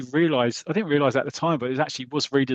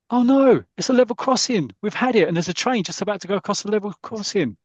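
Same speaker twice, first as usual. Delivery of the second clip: high and louder, alarmed shout; terrified passenger, much higher and louder; panicked cry; 8 semitones higher, 3 dB louder than usual. Acted. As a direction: yell it as the train looms. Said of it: "very dramatic".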